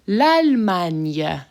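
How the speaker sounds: slow, careful French L'Allemagne, lahl-MAHN-yuh; French pronunciation. Shown as a demonstration. Teacher modeling for students, slow careful French. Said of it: "So here we go".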